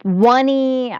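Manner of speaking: "one E" said slowly as WUN-ee; "one E" starting with a W sound, stretched out; alternative ticker reading. Shown as a demonstration. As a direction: draw out "one E", like the number one plus ee. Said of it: "however you wanna pronounce this ticker".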